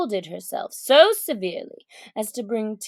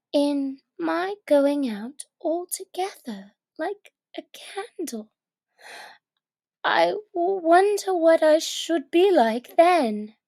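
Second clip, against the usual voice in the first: put-on higher girl's voice pondering nervously; Alice musing; 6 semitones higher than usual. Alice to herself about the candle, nervous musing. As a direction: wonder aloud like a small girl.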